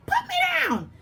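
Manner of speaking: very high squeal of panic; panicked plea. Acted. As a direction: squeal to be let down. Knight held by dragon.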